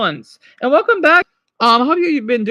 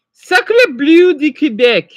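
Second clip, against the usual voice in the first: slow, louder Quebec French "Sacre bleu"; Quebec French exclamation; 5 semitones higher, 6 dB louder, slower than usual. Shown as a demonstration. Teacher doing a Quebec French oath, slow and loud.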